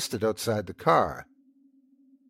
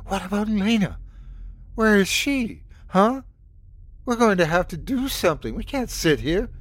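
rising panic; 10 semitones higher than usual. Acted. Sandy panicking, pressing.

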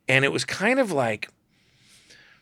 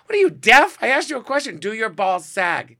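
much higher, mock-impatient brassy diva; Joan Rivers; 9 semitones higher, 5 dB louder than usual. Joan demanding an answer, brassy mock impatience. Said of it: "She goes".

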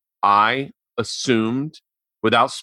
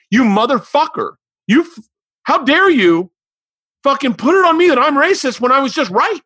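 shrieking high furious tirade, loud; furious rant; 11 semitones higher, 4 dB louder than usual. Retold or imitated. Retelling yelling at the boyfriend, shrill furious rant. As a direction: explode at him in outrage.

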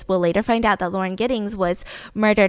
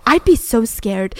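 ordinary talk

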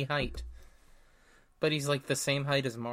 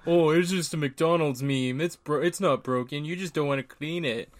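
mocking; 3 semitones higher, 6 dB louder than usual. Mocking customers' complaint.

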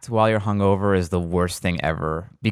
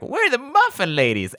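put-on chirpy, much higher old-lady voice; sweet old ladies; 11 semitones higher than usual. Old ladies introducing themselves to the camera, chirpy and high. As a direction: lightly play the proud muffin ladies. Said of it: "they're like".

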